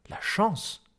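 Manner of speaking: high, stunned question; incredulous echo. A narrator's reading. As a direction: echo it in disbelief, pitch jumping up. Incredulous echo, then the answer. (incrédule, voix aiguë)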